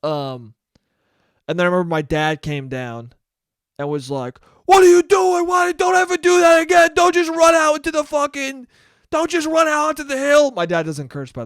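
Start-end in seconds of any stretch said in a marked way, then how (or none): 4.7-10.5 much higher, loud angry shout; dad screaming. Retold or imitated. Dad screaming at his young son after a sledding scare. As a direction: scream at your kid who just ran into danger. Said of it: "the way my dad screamed at me".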